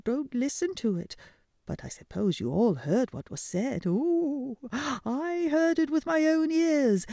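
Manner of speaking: high fluttery mother owl voice; fussy mother owl. Acted. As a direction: flap and fuss like a mother owl.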